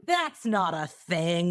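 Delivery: slow, mock-sincere denial; drawn-out sarcastic disbelief. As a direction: stretch it out with heavy sarcasm. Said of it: "that was sarcasm".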